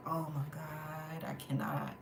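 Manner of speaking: slow, low, hushed disbelief; quiet exasperation. Retold or imitated. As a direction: drag it out softly, utterly done. Retells her exasperated reaction.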